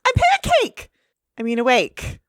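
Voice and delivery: high, startled squeak then recovery; startled cartoon pony. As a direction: jolt awake, flustered and high. Twilight waking up groggy.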